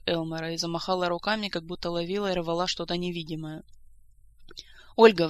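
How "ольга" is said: loud pleading call; urgent acted entreaty; imploring. A narrator's reading. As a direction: call the name as a plea. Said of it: "взмолилась она".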